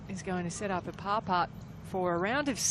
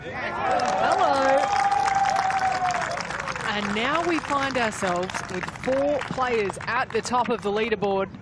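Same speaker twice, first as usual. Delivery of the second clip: loud, delighted golf call; excited commentator; 5 semitones higher, 7 dB louder than usual. Real, not acted. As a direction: lift the voice as the putt drops. Birdie putt drops.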